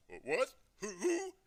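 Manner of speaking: startled, high-pitched loud stammer; voice gone funny. A narrator's reading. Father shocked by who is on the phone, voice goes funny. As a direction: squeak it out in shocked disbelief. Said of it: "his voice goes all funny".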